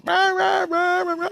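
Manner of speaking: very high, loud jeering gibberish; mock kid yelling. Storyteller mimics kid atop the steel structure, mock high yell. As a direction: imitate a kid's squealed yelling. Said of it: "he's yelling at".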